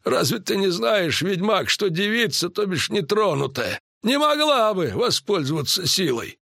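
high-pitched druid character voice, lecturing. Acted. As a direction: explain it knowingly in a high voice. (высокий голос друида, поучительно)

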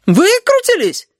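very high, drawn-out incredulous question; amazed echo. A narrator's reading. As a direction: squeal it back in disbelief. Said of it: "изумился ящер". (изумлённо, высоким голосом)

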